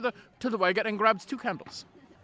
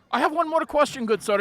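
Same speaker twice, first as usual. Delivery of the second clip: eager request; 7 semitones higher, 6 dB louder than usual. Acted. Bard presses with one more question.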